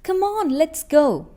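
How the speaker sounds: high, loud call to hurry up; rally a dawdling friend out the door; urging shout. Acted. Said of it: "You shout".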